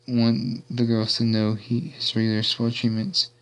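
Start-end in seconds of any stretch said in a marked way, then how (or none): none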